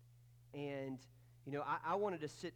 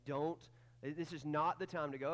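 normal talking voice

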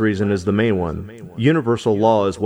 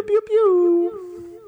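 high zapping pew pew; laser pew sound. Pretend. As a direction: zap like a space laser.

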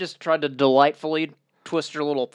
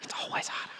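whisper; 15 dB quieter, faster than usual. Real, not acted. Dry aside about the heat.

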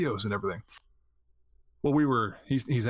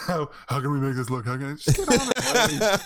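mock fussy streamer; 3 semitones higher, 5 dB louder than usual. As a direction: play the fussy streamer, whining.

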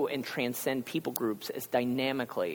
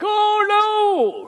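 much higher and louder shocked yelp; horrified cry; 11 dB louder than usual. Retold or imitated. Sailors hearing Jonah's God is the Hebrew God, shocked shriek. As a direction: shriek it like the worst news possible. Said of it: "I love the reaction right here".

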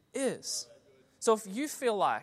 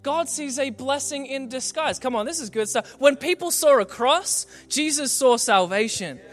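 rising, ever louder and higher climax; full-force preaching; 5 semitones higher, 9 dB louder than usual. Acted. Preacher building the congregation to a climax, full force. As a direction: drive it home, building to a shout.

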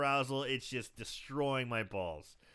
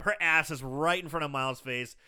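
ordinary talk

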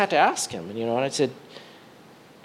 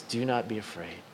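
tender reassurance; 6 semitones lower, 12 dB quieter than usual. Acted. God's reassurance in sermon.

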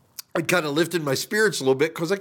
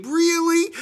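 high, squeaked disbelief; acted-out pained disbelief; incredulous; 3 dB louder than usual. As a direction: squeal it as an exasperated question. Incredulous reaction retold.

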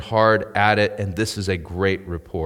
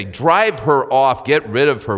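louder, higher, emphatic mock order; mock dismissal; 4 semitones higher, 6 dB louder than usual. Boaz as expected, rejecting a foreign gleaner, in emphatic mock dismissal.

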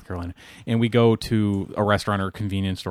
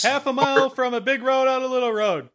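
car dealer ad yell; 13 semitones higher than usual. Shown as a demonstration. Imitating a yelling car lot ad for a friend, hammed-up. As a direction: shout it like a local car lot commercial. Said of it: "Kinda, kinda like this?".

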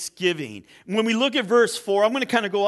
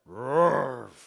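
low dog bark that sounds like Roast; 9 semitones lower than usual. Acted. To the butcher, the dog barks its order, low. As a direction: bark it deep like a big dog.

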